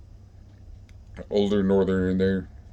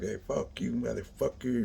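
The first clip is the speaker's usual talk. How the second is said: quiet, higher mock challenge; mock confrontation; 4 semitones higher, 10 dB quieter than usual. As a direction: act out a hostile callout under your breath. Imitates a hostile confrontation in the yard.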